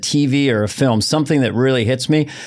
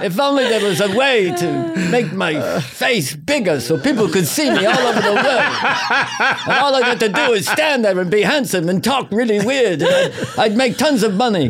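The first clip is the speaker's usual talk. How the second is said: a vain star daydreaming through laughter; Cary Grant impression; 8 semitones higher than usual. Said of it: "the handsome movie star".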